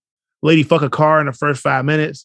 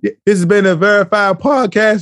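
louder, higher podcast announcer sign-off; announcer outro; 6 semitones higher, 5 dB louder than usual. Shown as a demonstration. Closing the podcast on his cohost's request, announcer voice. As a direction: proclaim the show name like a host. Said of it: "Hey, close this out".

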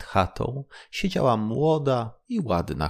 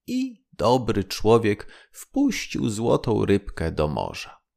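usual speaking voice

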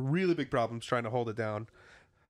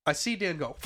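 ordinary delivery

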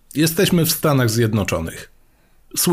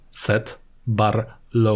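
"Seth Barlow" drawn out very slowly and quietly, with a pause before it; slow dramatic punchline; 3 semitones lower, 3 dB quieter, much slower than usual. Shown as a demonstration. Host re-delivers the pun for the co-host, slow and quietly dramatic. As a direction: let "Seth" hang, then land "Barlow" slowly like a drumroll. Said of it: "Poprzeczka zawieszona".

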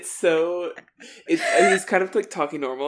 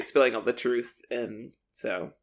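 ordinary delivery

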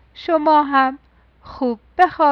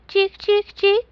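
higher chirping bird sound; sparrow chirp; 6 semitones higher than usual. A narrator's reading. Sparrow claiming the hat as its nest, chirping higher. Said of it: "گنجیش ... گفت".

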